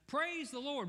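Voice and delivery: high ringing call; shouted praise. Retold or imitated. Lady calling out praise to the neighbourhood, high. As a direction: shout it out, no matter who hears. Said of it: "she would just shout".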